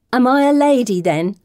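lightly voiced show-off, starting high and loud; a hint of strutting pride; preening character voice. A narrator's reading. May struts in new silks.

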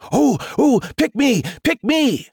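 high, drawn-out eager pleas; squealing, frantic to be chosen; frantic excitement. A narrator's reading. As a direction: beg like an overexcited pupil. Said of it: "frantic in their excitement".

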